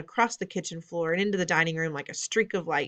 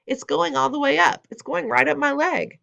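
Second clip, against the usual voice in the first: high, louder panicked cook; panicked yelling; 8 semitones higher, 5 dB louder than usual. A narrator's reading. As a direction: squeal it in alarm. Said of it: "the man was yelling".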